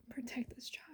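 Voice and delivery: fading whispered repeat; whispered plea. Retold or imitated. Repeating the nightly prayer, whispered. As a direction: let the prayer die into a whisper. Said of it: "I'd pray every single night".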